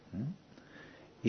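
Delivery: ordinary talk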